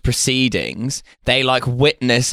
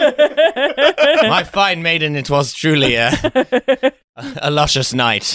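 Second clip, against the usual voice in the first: very slow, high, laughing courtly voice; hammed-up courtly suitor; medieval letter voice; 3 semitones higher, slower than usual. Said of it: "message written on vellum".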